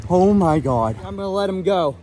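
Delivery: ordinary talk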